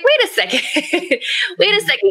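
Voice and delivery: high-pitched, laughing double take; retold disbelief. Guest retelling her reaction to everyone claiming her title, amused disbelief. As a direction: laugh through a mock-startled objection, pitched well up.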